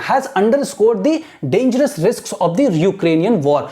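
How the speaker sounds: very slow, careful reading aloud; teacher reading for imitation; slow model reading. Shown as a demonstration. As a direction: read slowly for students to copy. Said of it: "imitate करना है".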